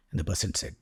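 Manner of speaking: usual speaking voice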